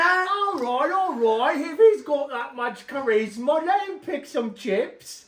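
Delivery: high, loud hammed-up British; a playful British concession; mock British accent. Uncle conceding to nephew in mock British accent. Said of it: "British boy".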